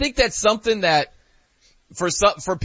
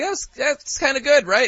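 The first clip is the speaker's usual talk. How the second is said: higher-pitched, satisfied fan voice; impressed listener; 9 semitones higher than usual. Retold or imitated. Fan walking away from a word-salad answer, lightly impressed. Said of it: "you just walked away like".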